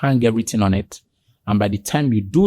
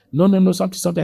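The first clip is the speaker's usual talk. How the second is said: mock objection; 8 semitones higher, much faster than usual. Retold quarrel with wife.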